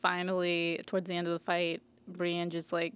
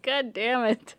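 higher, louder mock cursing; mock frustrated curse; 5 semitones higher, 4 dB louder, slower than usual. The dying Hound curses, a mock frustrated outburst. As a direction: curse like a man who lost. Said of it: "he's like".

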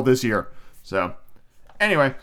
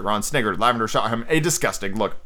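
usual speaking voice

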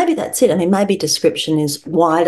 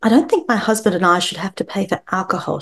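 normal talking voice

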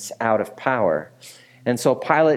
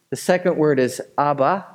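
ordinary talk, nothing marked

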